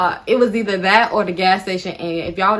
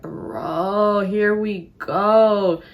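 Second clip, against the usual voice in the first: very drawn-out, fed-up groan of words; exasperated; much slower than usual. Retold or imitated. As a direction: stretch it out in exasperation. Fed up as questions pile up.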